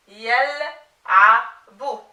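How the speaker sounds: yal'abu broken into slow, separate syllables; very slow, segmented "yal'abu" for a learner. Shown as a demonstration. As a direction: sound out "yal'abu" piece by piece, drawn out. Teacher assembles word from spelled syllables.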